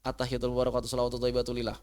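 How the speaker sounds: rattled off too fast; rushed wrong reading. Shown as a demonstration. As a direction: rush through it the wrong way. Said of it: "Jangan sampai baca begini nih". (bacaan tahiyat terburu-buru yang salah)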